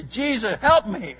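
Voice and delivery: short desperate plea, acted out; urgent prayer. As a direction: call out as if seconds remain. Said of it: "there's no time for long prayers".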